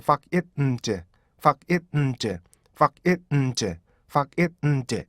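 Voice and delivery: find in four beats, 팍-잇-음-드; staccato breakdown of find; detached syllables. Shown as a demonstration. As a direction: chop fuck it, 음, 제 beat by beat. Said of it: "이렇게 하셔야 돼요".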